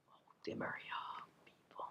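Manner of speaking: a secret, thrilled whisper; hushed excitement. Real, not acted. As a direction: whisper the name so mom can't hear. Scared mom will come in.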